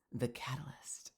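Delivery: breathy hushed whisper. Pretend. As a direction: whisper the word like a secret. Teasing repeat of Mel's word.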